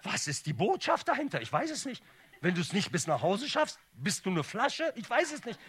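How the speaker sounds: high, loud, exasperated questioning; mock bafflement. Comedian questions the message behind the deposit voucher, loud mock bafflement. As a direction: throw the questions out in high-pitched comic despair.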